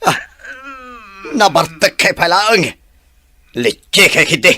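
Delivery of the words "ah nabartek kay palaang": ordinary talk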